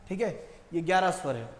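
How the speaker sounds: ordinary talk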